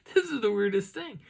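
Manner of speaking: high, loud, laughing disbelief; amused amazement. Retold or imitated. Walker marvels at the strange whooping, high amused amazement. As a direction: exclaim it high, baffled and tickled.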